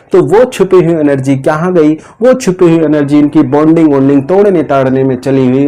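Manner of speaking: normal talking voice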